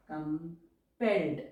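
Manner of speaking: drawn out word; slow dictation. Shown as a demonstration. Teacher dictating an English phrase to shorthand students, slowly.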